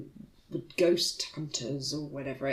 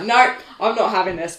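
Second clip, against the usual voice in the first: mock refusal; 8 dB louder than usual. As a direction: put your foot down loudly. Retelling her refusal of the ghost.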